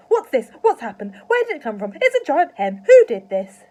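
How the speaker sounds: high, very loud frantic questions; frantic, jumping-about shouting; excited shouting. A narrator's reading. Excited father waving his arms.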